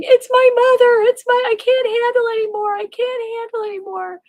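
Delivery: sobbing to the cops as they take her away. Retold or imitated. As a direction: cry it out, overwhelmed. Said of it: "I'm sobbing".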